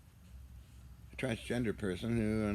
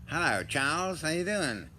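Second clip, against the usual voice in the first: mock greeting; 6 semitones higher, 7 dB louder than usual. Greeting Charles in put-on voice.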